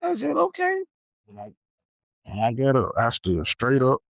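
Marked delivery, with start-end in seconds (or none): 0.0-0.8 very high, squeaky retort; put-on high little voice; mock-sweet okay. Kid answering teacher who ordered him out, mock-sweet okay.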